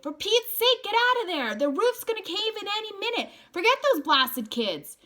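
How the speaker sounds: loud, high frantic scream. Acted. Dally screaming at Ponyboy to get out before the roof caves. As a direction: scream up at the window in panic. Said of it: "he screamed".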